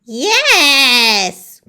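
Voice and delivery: bright excited cry; joyful yes. Acted. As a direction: cheer it out. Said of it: "cried the witch".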